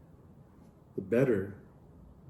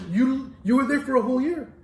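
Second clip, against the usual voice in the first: higher, pressing in disbelief; incredulous; 10 semitones higher, 4 dB louder than usual. Retold or imitated. Professor questioning the student, amazed disbelief. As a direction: demand how it's possible, amazed.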